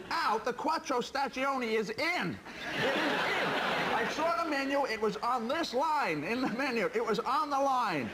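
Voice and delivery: disputing the missing pizza as if contesting a line call, high-pitched outrage; McEnroe tantrum. Acted. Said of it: "McEnroe getting in a tiz".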